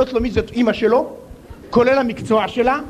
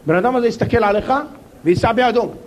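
ordinary talk, nothing marked

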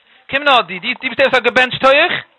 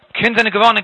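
ordinary delivery

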